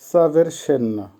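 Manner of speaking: ordinary talk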